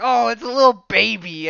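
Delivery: mock villainous delight. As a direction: coo over prey in hammed-up delight. Carnage spots baby, retold.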